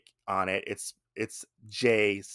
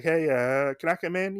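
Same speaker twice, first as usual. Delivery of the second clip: tentative visitor's request; 6 semitones higher than usual. Retold or imitated. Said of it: "he's like".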